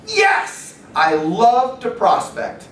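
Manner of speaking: forced triumphant cheer. Retold or imitated. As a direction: fake pumped-up joy. Forced cheer after rejection.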